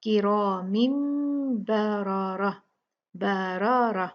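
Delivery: heavy ra in बरारा; recitation. Shown as a demonstration. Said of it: "अलग-अलग हमने सब पढ़ना है".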